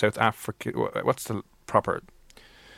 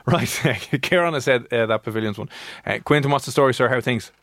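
usual speaking voice

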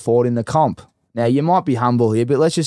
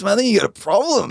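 high, quick retold disbelief; mock alarmed coach; 9 semitones higher, faster than usual. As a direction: imitate a coach aghast at the answer. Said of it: "the coach lost his mind".

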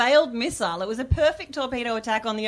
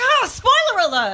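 much higher, louder mock outrage; exclaiming; 12 semitones higher, 8 dB louder than usual. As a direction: blurt it out indignantly. Outburst at spoiler.

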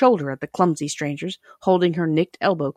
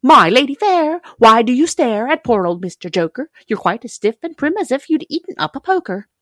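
high, loud teasing clown; saucy clown; 7 semitones higher, 6 dB louder than usual. A narrator's reading. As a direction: tease the lady with showy cheek. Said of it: "nodding his head at them saucily".

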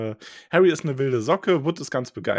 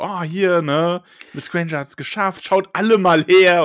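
high, loud, delighted little professor; calling the class to look, thrilled; hammed-up squeaky teacher; excited Flitwick; 7 semitones higher, 7 dB louder than usual. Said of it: "Flitwick so".

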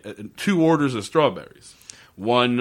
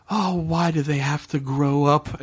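creepy wistful villain; 5 semitones higher than usual. Retold or imitated. Imitating creepy villain line.

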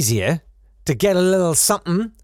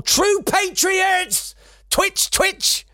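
very high, strained comic squeal; mock patriotic fervour; 13 semitones higher, much slower than usual. Comedian mocking the poster writer's sign-off, high frenzied voice. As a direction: build to a high, twitchy, overwrought cry.

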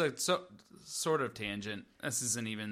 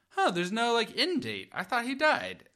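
puzzled realization; 10 semitones higher, 5 dB louder than usual. Retold or imitated. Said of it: "I was like".

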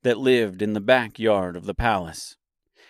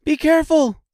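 put-on very high, loud girl's voice; high-pitched alarmed cry; 6 dB louder than usual. A narrator's reading. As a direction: call out a warning, high. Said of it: "cried the green girl".

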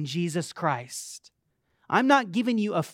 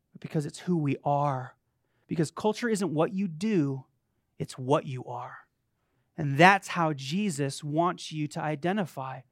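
ordinary delivery

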